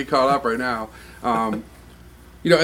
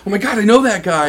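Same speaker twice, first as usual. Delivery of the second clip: imitating a thrilled fan, higher and louder; excited fan; 7 semitones higher, 6 dB louder than usual. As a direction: gush like you just spotted a friend's name. Fan reading album thank-you list.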